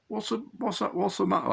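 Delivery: attempted regional accent, pitched high; Wayne Swinsko's accent. Angler calls out to friend in trouble, attempted regional accent. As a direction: have a rough go at his regional accent, high-voiced. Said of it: "I can't do the accent".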